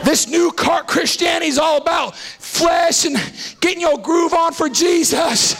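high, shouted revival climax; full-throated, rhythmic rallying cry; fiery preaching. Real, not acted. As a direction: whip the congregation up, phrase after driving phrase. Builds the congregation up to shout.

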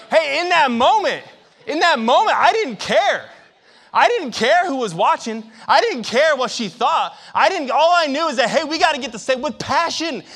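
loud, high passionate sermon; fiery preaching. Acted. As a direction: drive the congregation toward passion. Preaching at full force.

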